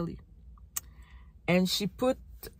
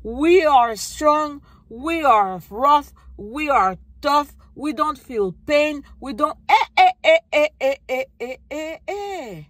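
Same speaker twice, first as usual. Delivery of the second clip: mock toughness; 5 semitones higher, 5 dB louder than usual. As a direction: hammer out each claim, then break into chanted ehs.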